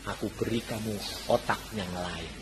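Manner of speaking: deep, very soft pronouncement; God's voice. Retold or imitated. God giving the pupil another brain, hushed divine voice. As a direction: drop low and hushed to grant a gift. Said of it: "Tuhan bilang".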